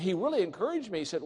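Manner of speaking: normal talking voice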